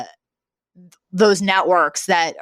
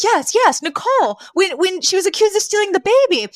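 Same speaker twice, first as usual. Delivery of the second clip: much higher, fast, nasal-free put-on Fran whine; Fran's frantic plea; 11 semitones higher than usual. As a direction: blurt it out in a panicky high character voice. Fran explains the soap plot.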